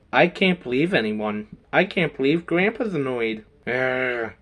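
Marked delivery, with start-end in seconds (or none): 3.7-4.3 low, soft growl; monster growl. Pretend. Monster toy growls at the hero. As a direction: grumble like a small beast.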